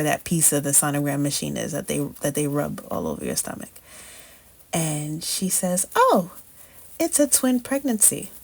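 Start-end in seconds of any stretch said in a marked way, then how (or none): 6.0-8.3 chirpy sonographer. Retold or imitated. Sonographer announcing twins.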